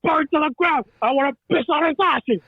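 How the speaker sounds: high, ranting mob boss delivery; De Niro as Capone. Acted. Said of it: "There's such amazing delivery there".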